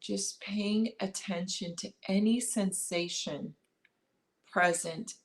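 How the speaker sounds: calm meditation guide, long pauses between phrases; unhurried body-scan guidance, leaving space after each instruction; guided meditation. Acted. Guiding listeners to notice breath and body, guided meditation.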